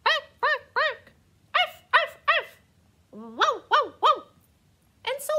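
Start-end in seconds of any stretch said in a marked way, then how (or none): 0.0-2.6 high, loud playful barks; yappy mock dog barks; barking. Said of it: "make dog sounds like dogs make". 3.1-4.2 loud playful woofs; mock dog woofing. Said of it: "make dog sounds like dogs make".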